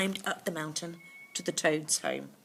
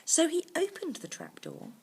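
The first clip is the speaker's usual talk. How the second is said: plain reading voice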